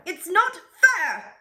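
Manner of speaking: high, loud, slow tantrum cry, falling at the end; bratty wail again. Acted. The duke repeats his complaint, high, slow tantrum wail. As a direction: wail like a spoiled duke stamping his bow. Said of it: "he sounds terrible".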